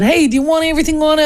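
quick, high, lightly voiced New York vendor; hot dog vendor. Retelling the hot dog man offering toppings, quick and high. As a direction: fire it off like a busy street vendor. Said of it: "And the guy said".

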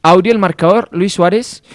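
slow, drawn-out scoreboard announcement; announcer score read. Acted. Host announces the Mexico-Colombia result, slow announcer style. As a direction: call out the score, stretching the words.

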